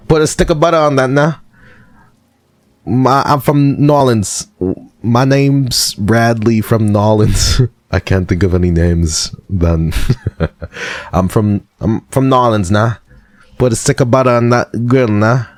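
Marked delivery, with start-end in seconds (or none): 0.1-7.6 put-on Louisiana accent. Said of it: "Is that a good Nolens accent?". 11.1-15.4 high, hammed-up Louisiana twang; Louisiana accent. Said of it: "Is that a good Nolens accent?".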